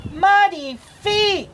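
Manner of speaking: high, mock cry of dismay; cried out. A narrator's reading. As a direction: cry it out like an upset mother. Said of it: "she cried".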